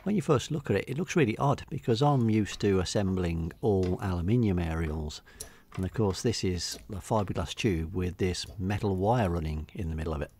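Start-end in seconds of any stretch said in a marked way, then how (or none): none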